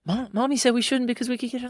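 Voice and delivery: put-on high, childlike little voice; imitating a child. A kid telling dad what mommy said, in a high childlike voice. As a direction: play a small kid quoting mommy. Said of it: "You're like".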